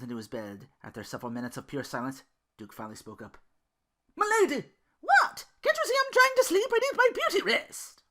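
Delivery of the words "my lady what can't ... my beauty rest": high, loud indignant squeal; annoyed snap. Acted. Belle woken by Duke, snapping at him in a high loud voice. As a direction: bite his head off, half laughing.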